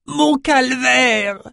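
high, slow, drawn-out moan; dying moan. Acted. Disembowelled shaman moans for his king, slow high moan. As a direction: moan it out long as life leaves. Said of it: "gémit Crayeux".

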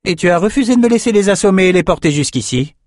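high, exasperated accusing question; indignant reproach. A narrator's reading. Lila glaring at Bannon, indignant reproach. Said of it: "Elle foudroya Bannon du regard".

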